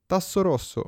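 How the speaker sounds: verdetto gridato, acuto; grido del cappello. A narrator's reading. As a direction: lancia il verdetto con voce alta. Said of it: "gridò ancora il cappello". (verdetto gridato)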